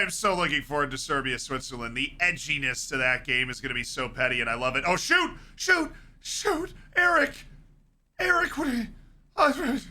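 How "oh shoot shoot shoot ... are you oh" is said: panicked shouting. Real, not acted. Streamer reacting to a dangerous moment in the match, panicked yell. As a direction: shout in alarm as the chance unfolds.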